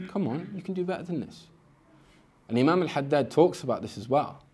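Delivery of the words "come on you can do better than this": higher mock self-coaching; self pep talk. Spiritual seeker urging his own nafs on, higher mock pep talk. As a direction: push yourself on, lightly voiced. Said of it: "They spoke to themselves".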